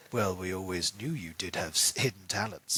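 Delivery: low, soft, knowing aside; lightly voiced smiling Vincent; Vincent's line. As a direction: drop the voice low for a sly remark. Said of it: "Vincent kind of smiles and goes".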